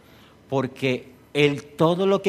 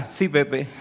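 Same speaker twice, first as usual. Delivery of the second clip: mock sarcastic; 3 semitones lower, 4 dB quieter than usual. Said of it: "Dios ... nos dirá".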